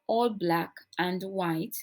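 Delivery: ordinary delivery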